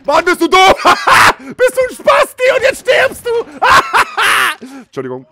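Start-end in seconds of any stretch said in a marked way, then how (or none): 0.1-4.8 very high, loud yelling between laughs; laughing yell. Real, not acted. Gamer yells laughing at an enemy, very high and loud. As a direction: scream half-laughing at the game.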